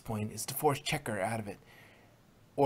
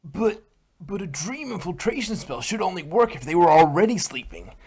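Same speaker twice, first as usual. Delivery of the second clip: higher, louder anxious mare voice; alarmed and pleading, pitched up; worried question; 4 semitones higher, 7 dB louder than usual. Acted. Worried pony asks about the spell.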